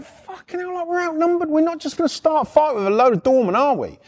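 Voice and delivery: high-pitched retold protest; mock indignant squeak; incredulous protest. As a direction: voice jumps up high, pleading innocence.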